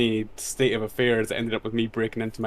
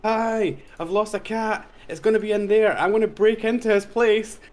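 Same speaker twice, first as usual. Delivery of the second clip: higher, loud calling across; calling out to neighbors; 10 semitones higher, 3 dB louder than usual. Retold or imitated. To the other neighbors, a loud higher call. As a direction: call out to be heard next door. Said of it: "shout at my neighbors".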